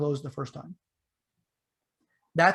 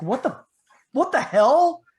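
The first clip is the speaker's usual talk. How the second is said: startled, high-pitched outburst, louder on the repeat; imitating a man's loud, alarmed disbelief; freaking out; 9 semitones higher, 7 dB louder, slower than usual. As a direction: jump in pitch like someone just spooked. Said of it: "he's freaking out".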